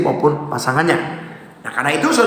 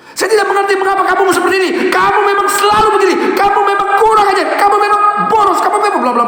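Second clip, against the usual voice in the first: accusing shout; 13 semitones higher, faster than usual. Shown as a demonstration. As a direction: hammer each accusation home. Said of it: "Misalnya gini".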